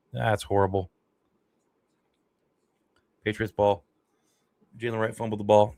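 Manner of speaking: ordinary talk, nothing marked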